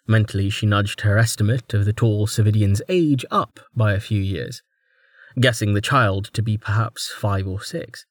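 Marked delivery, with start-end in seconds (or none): none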